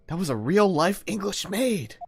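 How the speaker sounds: usual speaking voice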